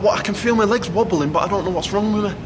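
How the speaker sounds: asking for help, not understanding; confused plea. Retold or imitated. Fighter in the corner asking trainer Dom, bewildered plea.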